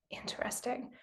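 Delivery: breathy whispered musing, rising at the end; soft whispered wondering; whispered aside. Retold or imitated. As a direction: whisper it to yourself like a quiet discovery. Private realization, retold inner voice.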